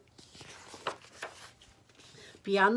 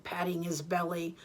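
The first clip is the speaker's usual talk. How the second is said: ordinary delivery, nothing marked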